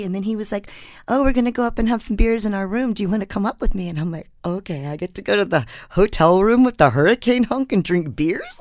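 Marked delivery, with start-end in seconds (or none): 1.1-3.9 higher, louder breezy invite; eager retold invitation. Weatherman inviting the reporter up for beers, eager retold invitation. 4.5-8.6 loud, playful swoon rising at the end; giddy mock thrill. Reporter retelling her thrill at the hunk's invite, giddy mock thrill.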